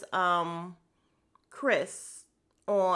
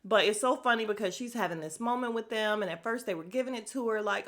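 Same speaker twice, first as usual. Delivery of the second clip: usual speaking voice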